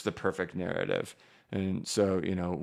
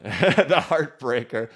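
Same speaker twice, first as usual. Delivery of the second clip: laughing; 5 semitones higher, 6 dB louder, much slower than usual. Real, not acted. Laughing at a losing coin.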